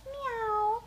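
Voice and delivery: high, drawn-out meow; cat's meow. A narrator's reading. Mother cat crying out at night, high meow. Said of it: "cried the cat".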